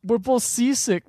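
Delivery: high, loud comic wail; mock seasick dismay. Co-host voices two seasick characters on the boat, mock dismay. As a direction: whine it out, hammed-up.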